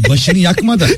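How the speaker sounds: high burst of laughter; nervous laugh. Real, not acted. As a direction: laugh it out then speak. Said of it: "Sinirden gülüyorsun bence".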